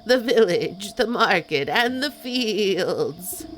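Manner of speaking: loud, slow goat voice; slow ominous goat voice. Acted. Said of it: "I'm glad you like the goat voice".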